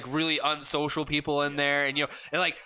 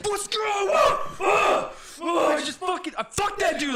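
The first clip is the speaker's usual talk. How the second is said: much higher, louder triumphant screaming; victory yell; 14 semitones higher, 4 dB louder, slower than usual. Retold or imitated. Player celebrating a win, triumphant yell. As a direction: roar after outplaying him.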